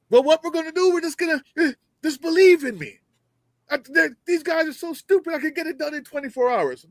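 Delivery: high, smug mock politician voice. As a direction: parody a clueless candidate bragging. Mocking a Democrat's weak pitch.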